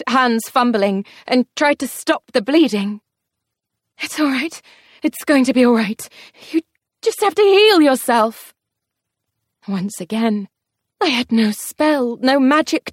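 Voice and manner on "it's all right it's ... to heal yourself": frantic reassurance. Acted. Frantic comfort to dying Wrath.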